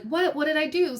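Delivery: high-pitched innocent protest; mock innocence. Tactless friend after making a mess, mock innocence. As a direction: imitate clueless surprise, pitch lifted throughout.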